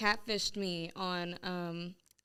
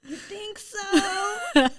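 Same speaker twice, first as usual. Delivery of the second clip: high, slow, drawn-out mock surprise; sarcastic fake innocence, stretched out; sarcastic reply; 13 semitones higher, 7 dB louder, much slower than usual. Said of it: "And I'm like".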